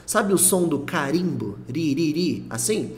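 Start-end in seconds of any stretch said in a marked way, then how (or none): none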